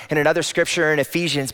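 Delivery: ordinary talk, nothing marked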